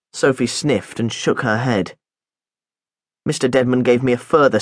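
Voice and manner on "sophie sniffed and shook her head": ordinary delivery, nothing marked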